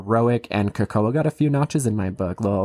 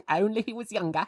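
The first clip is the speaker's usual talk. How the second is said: higher, softer mock-cockney echo, laughing; cockney accent; 9 semitones higher, 5 dB quieter than usual. Echoing co-host's quote of a smitten woman, mock cockney. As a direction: parrot it back in bad stage cockney. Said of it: "you sound like you're in, um, Mary Poppins".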